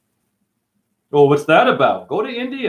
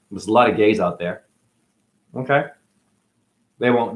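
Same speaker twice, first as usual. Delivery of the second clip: normal talking voice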